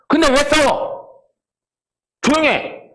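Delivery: mock stern scolding. Pastor in the middle of the families, a mock stern high-pitched scolding. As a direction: play the pastor cutting off a family fight. Pastor silencing quarreling in-laws.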